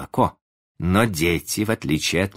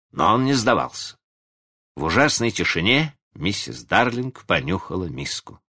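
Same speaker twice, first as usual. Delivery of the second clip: usual speaking voice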